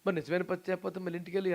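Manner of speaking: usual speaking voice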